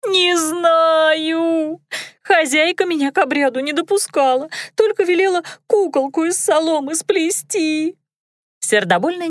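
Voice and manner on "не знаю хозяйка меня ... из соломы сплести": high, fast squeaky maid; squeaky girl voice. A narrator's reading. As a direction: chatter in a thin squeak. Dunka squeaking.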